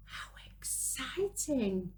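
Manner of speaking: breathy secret whisper of delight; excited whisper. A narrator's reading. Aside to young listeners.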